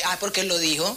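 usual speaking voice

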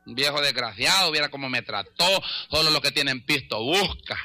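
low, mock resentful gossip; bitter grumbling. Offended member complains about the pastor, bitter grumbling.

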